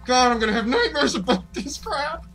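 high, laughing in fear; yell, then break into a scared laugh; nervous laughing. Real, not acted. Gamer laughing through fear at the game.

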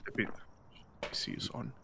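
hushed whisper; whispering while hiding. Pretend. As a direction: whisper so the ghost can't hear. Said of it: "I'm hiding in the closet".

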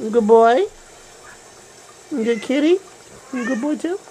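high, rising praise for a cat; high sing-song pet talk. Real, not acted. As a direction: fuss over the kitty in a high voice.

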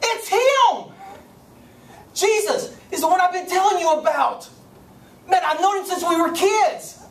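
high, loud, overjoyed outburst; joyful excited shout. Acted. John spots Jesus he waited his whole life for, joyful shouting. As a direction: shout it out like you've finally found him. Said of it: "he shouted with joy and excitement".

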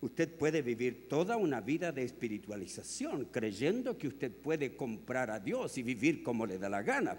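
ordinary talk, nothing marked

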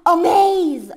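gasping "a maze!" in surprise; gasp, then blurt it out; excited gasp. Real, not acted. Sudden excited discovery.

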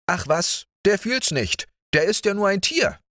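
high, fast, callous scoff; heartless dismissal. A narrator's reading. Georg dismisses the dog's pain, high and heartless. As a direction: toss it off as coldly as possible. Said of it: "sagte so herzlos wie nur möglich".

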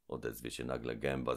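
usual speaking voice

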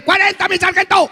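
very high, eager soldier voice; dumb soldier voice. Acted. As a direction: answer like a clueless recruit. Dim soldier shouts his answer.

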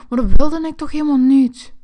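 a loud, outraged complaint; loud disappointment. Acted. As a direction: kid wailing that he never wanted this. Disappointed by the gift.

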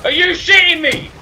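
loud high-pitched outraged yell; raging gamer. Real, not acted. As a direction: shout it in disbelief. Gamer raging after death.

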